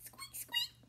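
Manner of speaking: very high tiny squeak; mouse squeak. Shown as a demonstration. Teacher shows children a mouse's tiny squeak. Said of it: "A mouse says".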